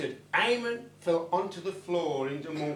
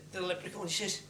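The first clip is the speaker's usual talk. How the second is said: ordinary delivery, nothing marked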